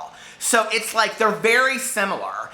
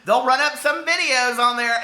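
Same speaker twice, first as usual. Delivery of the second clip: high, sassy warning voice; saucy lady; 7 semitones higher than usual. Retold or imitated. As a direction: play the sassy lady wagging a finger. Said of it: "she was real saucy".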